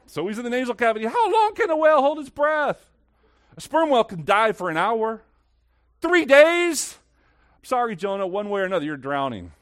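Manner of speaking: high, loud pulpit exclamation; impassioned preaching. Real, not acted. Preaches at full force.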